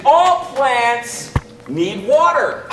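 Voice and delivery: all plants need water said slowly, water landing heavy; slow stressed line. Shown as a demonstration. Teacher cueing the helper with water slowly. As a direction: draw it out and hit water as a cue. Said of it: "every time I say the word water".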